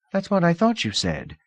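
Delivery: put-on breezy rich girl offering comfort; Lida, young hostess.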